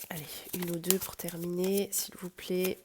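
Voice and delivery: soft whispered request; whisper, asking the cards. Real, not acted.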